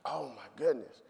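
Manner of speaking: breathless whisper of shock. Retold or imitated. Boy hearing that [name] just died, stunned whisper.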